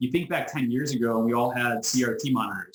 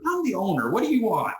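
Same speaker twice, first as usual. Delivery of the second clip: exasperated snap; 9 semitones higher than usual. Retold or imitated. Owner snapping at a pushy caller, impatient and high.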